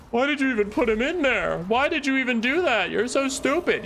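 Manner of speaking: high squeaky mock scolding. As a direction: scold them in a tiny high voice. Scolding own unit in squeaky voice.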